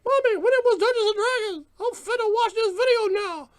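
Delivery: put-on very high, loud kid voice; put-on childish falsetto; excited twelve-year-old. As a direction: squeal to mom like an eager boy. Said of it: "that twelve-year-old kid".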